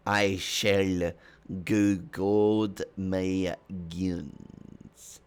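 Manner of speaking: slow, grandly stretched names; terrible boy impression. The boy addresses the rest of the party, slow drawn-out impression. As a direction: draw out every word theatrically. Game master plays the boy.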